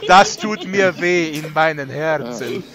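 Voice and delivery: wounded mock German lament; mock German accent. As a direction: clutch the heart, German accent. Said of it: "you already have a weird accent".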